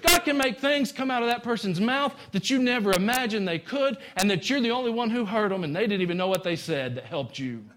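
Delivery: high, driving pulpit delivery; full-force preaching. Real, not acted. Preacher assuring church God speaks through people, fervent preaching. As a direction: preach it high, easing down at the end.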